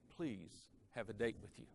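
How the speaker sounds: soft, careful and clear; smooth sincere request. Retold or imitated. Joe asking the campus beauty for a date, gently. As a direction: ask her gently, every word clean. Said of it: "a Shakespearean actor couldn't have said it".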